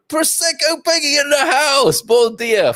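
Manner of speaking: high, far-off put-on voice; terrible mock impersonation of a viewer; Peggy impersonation. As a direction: ham up a viewer reading her comment. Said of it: "terrible impersonation".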